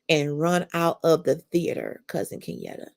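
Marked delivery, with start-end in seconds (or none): 2.1-2.9 breathless whispered aside. Real, not acted. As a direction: whisper it, choked up. Choked aside.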